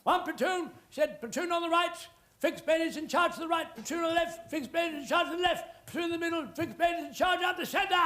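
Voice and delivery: high, ringing command voice; officer shouting battle plan; officer's battle orders. Retold or imitated. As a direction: call the charge like a pompous officer. Officer gives charge orders.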